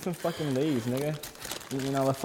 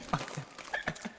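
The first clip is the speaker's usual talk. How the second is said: breathy whisper imitating a friend; whispered quote; 12 dB quieter than usual. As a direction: whisper it back mockingly. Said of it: "He said".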